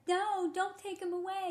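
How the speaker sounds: high, slow, tearful little plea; soft pleading boy. Retold or imitated. Retelling a boy's audition plea to keep his dog, tearful and high. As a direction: beg softly like a boy about to cry. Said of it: "Not, like, bawling".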